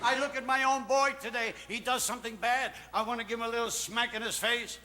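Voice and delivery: ordinary talk, nothing marked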